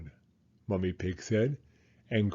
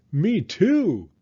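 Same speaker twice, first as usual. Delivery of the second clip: higher, loud happy shout; excited cry; 6 semitones higher, 7 dB louder than usual. A narrator's reading. Susie agreeing with Peppa, excited shout. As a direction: shout back eagerly. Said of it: "cried Susie".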